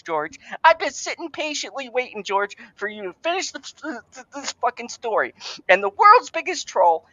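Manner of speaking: high-pitched, ranting fan outrage; worked-up, pleading tirade at a high pitch; exasperated rant. Acted. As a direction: tear into the author in a high, indignant voice. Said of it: "you knew the rant was coming".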